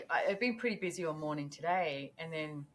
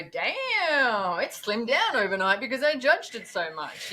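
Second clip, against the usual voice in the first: higher and louder, hammed-up delight; mock amazed exclamation; 5 semitones higher, 8 dB louder than usual. Mocking someone who hopes judging slims their butt, with hammed-up delight. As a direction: pretend you just noticed a miracle. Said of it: "You don't wake up in the morning and go".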